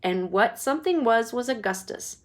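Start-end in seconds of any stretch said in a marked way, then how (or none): none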